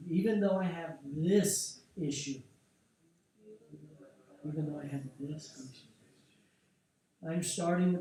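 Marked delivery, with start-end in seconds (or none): 0.1-2.4 spoken with energy. Shown as a demonstration. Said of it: "speak it with energy".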